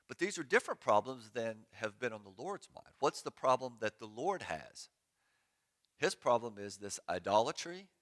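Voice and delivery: ordinary delivery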